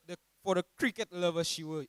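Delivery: ordinary talk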